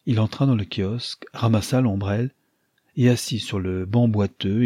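ordinary delivery

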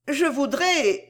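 high, tentative reply; hesitant. A narrator's reading. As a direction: start a wish and leave it hanging. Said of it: "répondit Cécile en hésitant".

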